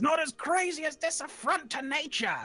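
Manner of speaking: high scornful mayor voice; mayor retort. Acted. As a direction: sneer it back at the gnome. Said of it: "And he says".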